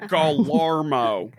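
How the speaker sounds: slow Texan-drawled French name; Texan-French accent. Retold or imitated. Recalling a Texan exchange student's French, with a Texan accent. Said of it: "French with a thick Texan accent".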